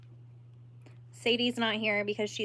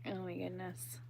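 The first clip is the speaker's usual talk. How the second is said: whispered aside; 13 dB quieter than usual. Real, not acted. Reacting softly to a chat comment.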